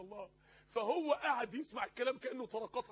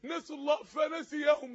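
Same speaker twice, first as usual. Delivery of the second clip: loud, drawn-out exhortation, ringing on key words; impassioned preaching; 2 semitones higher, 8 dB louder, slower than usual. Acted. Preacher pressing the congregation on their worth to God, impassioned. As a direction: preach it forcefully, stretching the long vowels.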